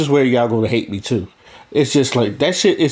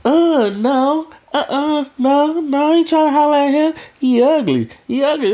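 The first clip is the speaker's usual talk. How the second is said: put-on high, fast, dismissive girl voice; mocking girl voice; 13 semitones higher than usual. Girl rejecting a boy she calls ugly, high mocking voice. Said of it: "She go".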